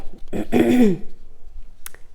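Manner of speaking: a rough cough to clear the throat. Real, not acted. Crafter clears her throat while showing the colours, then a cough. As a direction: clear a frog from your throat. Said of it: "j'ai un chat dans la gorge".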